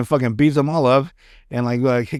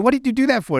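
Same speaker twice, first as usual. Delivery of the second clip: mock outraged Daniel; 9 semitones higher, faster than usual. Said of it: "Mister Miyagi's like".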